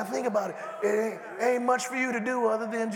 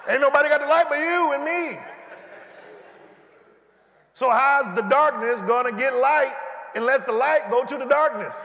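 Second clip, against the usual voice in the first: preaching shout; 5 semitones higher than usual. Acted. Preacher rallying the congregation, shouting. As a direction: call them out.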